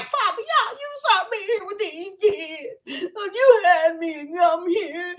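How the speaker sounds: high, drawn-out wailing plea; imitated crying. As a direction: act out sobbing to God, dragging the words. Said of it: "I was crying and I was saying".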